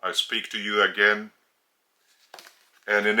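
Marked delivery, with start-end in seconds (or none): none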